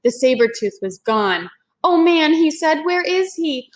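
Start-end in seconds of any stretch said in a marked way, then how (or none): 1.8-3.7 put-on high, louder worried boy; alarmed Jack. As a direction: fret about the missing tiger. Jack sees tiger gone.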